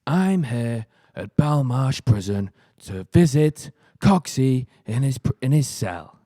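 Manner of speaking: slow, weighty documentary presenter; badly done presenter impression; Ross Kemp. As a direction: intone it haltingly like a TV reporter. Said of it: "He talks in that weird voice".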